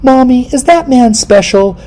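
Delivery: put-on high, slow little girl voice. Six-year-old girl asking her mother, high child voice. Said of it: "the little girl ... is like".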